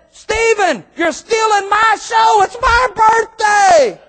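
mock-outraged host hamming it up; joking shout. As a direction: painter playfully booming at the boy. [name] jokes to the boy.

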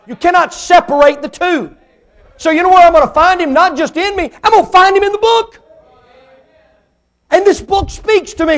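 preacher climbing to a fervent peak; preaching at full force. Real, not acted. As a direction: lift them up, preach it out.

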